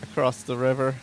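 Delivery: fleeing in a panic; being chased. Real, not acted. Fleeing a saber cat in game.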